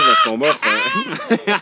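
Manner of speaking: reading while cracking up; can't get through the line for laughing. Real, not acted. First read breaks into laughter.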